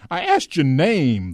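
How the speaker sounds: slow, loud, high demand; thundered. A narrator's reading. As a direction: thunder it out word by word. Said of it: "the boy thundered".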